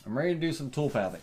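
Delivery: fast, tossed-off repeat; quick repeat. Real, not acted. Repeating the next step to viewers, fast. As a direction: rattle it off fast.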